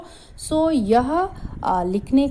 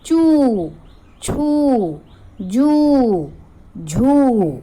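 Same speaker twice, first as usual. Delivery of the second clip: higher and louder, each syllable called out; loud drilled syllables; 5 semitones higher, 5 dB louder, much slower than usual. Shown as a demonstration. Teacher calling syllables to children, loud. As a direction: ring out चू छू जू झू. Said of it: "च पर बड़े ऊ की मात्रा".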